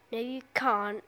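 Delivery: ordinary delivery, nothing marked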